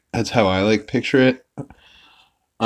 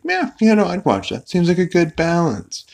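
lightly voiced, higher-pitched listeners; mock casual approval; 10 semitones higher than usual. Listeners answer Salty's cartoon pitch, mock casual approval. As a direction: imitate onlookers agreeing in a higher voice. Group's reply to a pitch, retold.

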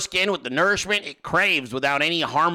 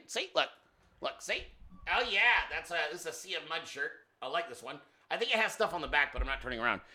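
off mic; 11 dB quieter, faster than usual. Real, not acted. Turned away showing shirt.

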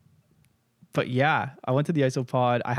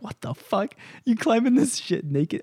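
shocked disbelief; 9 semitones higher than usual. Retold or imitated. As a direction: pitch jumps high in incredulous alarm. Told he won't need swimsuit.

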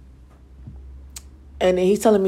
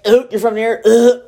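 louder, turned-up-nose ugh; disgusted; 3 semitones higher, 5 dB louder than usual. Retold or imitated. To the guy from that side of town, loud disgust. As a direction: recoil at where he's from. Disgusted reaction to his neighborhood.

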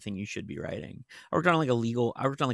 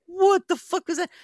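mock shocked outburst; 8 dB louder than usual. Retells her own shocked reaction.

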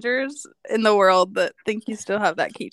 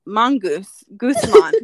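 hammed-up bad Jamaican accent; terrible Jamaican accent; 4 dB louder, slower than usual. Replaying words said to the bathroom mirror, terrible Jamaican accent. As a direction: attempt a Jamaican accent, badly. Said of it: "in a terrible Jamaican accent".

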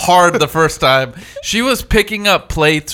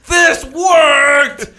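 triumphant shout; 8 semitones higher, much slower than usual. Retold or imitated. Slapping friend, celebrating.

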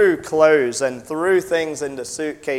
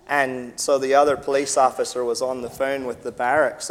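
usual speaking voice

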